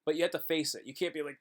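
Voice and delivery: ordinary delivery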